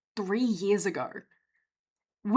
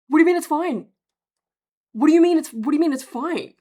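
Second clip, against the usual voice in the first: loud, higher-pitched disbelief; incredulous outburst; 7 semitones higher, 8 dB louder, faster than usual. Retold or imitated. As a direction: burst out in exasperated disbelief. Reacting to the optometrist's dismissal.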